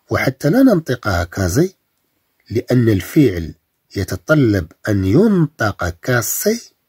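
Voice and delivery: ordinary delivery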